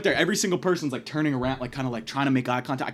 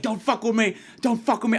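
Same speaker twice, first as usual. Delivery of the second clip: aggressive; 8 semitones higher than usual. Retold or imitated. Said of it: "he gets a little aggro".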